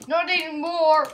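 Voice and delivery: loud sneering reversal; mock menace. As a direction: gloat as you turn on them. Monster sneers.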